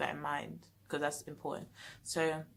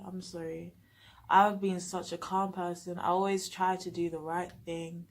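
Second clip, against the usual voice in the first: usual speaking voice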